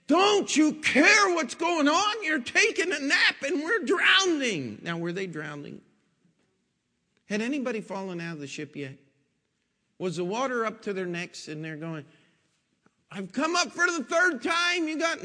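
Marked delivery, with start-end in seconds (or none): 0.1-4.8 high, loud frantic plea to a sleeping Jesus; panicked disciples. Retold or imitated. Disciples shouting at Jesus in the storm, panicked and high. 13.1-15.2 high, loud hammed-up gasping plea; mock drowning panic. Preacher mocking the disciples as drowning, high and loud.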